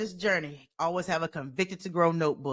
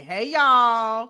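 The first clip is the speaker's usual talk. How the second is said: loud, drawn-out Southern greeting; playful show of a Southern US accent; Southern twang; 7 dB louder than usual. Shown as a demonstration. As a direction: holler it with a big Southern twang. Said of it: "I still got that Southern twang".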